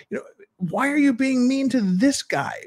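high, incredulous mock outrage; mock exasperated listener. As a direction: squeak it out like a fed-up juror. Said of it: "you're just like".

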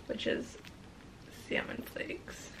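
soft unvoiced whisper; hushed early-morning whisper. Real, not acted. Early morning, hushed while filming.

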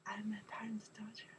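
soft whisper. Shown as a demonstration. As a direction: breathe it quietly. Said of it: "unvoiced whisper".

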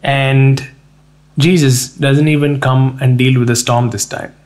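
ordinary talk, nothing marked

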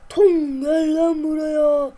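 loud, slow drawn-out call; angry call. Acted. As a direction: drag out his name to summon him. Calling friend angrily.

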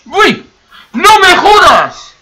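very high, loud, drawn-out cry of alarm; real high-pitched outburst of dismay; shocked yell. Real, not acted. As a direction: yell it out, shocked by a sudden mishap. Mishap in the game near lava.

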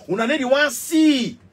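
ordinary talk, nothing marked

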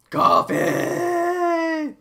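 long high loud call. Real, not acted. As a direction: call out for your coffee. Calling out for coffee.

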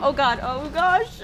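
ordinary talk

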